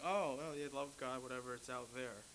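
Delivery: a hint of a bored churchgoer waving love away; mock indifference. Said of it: "We're thinking".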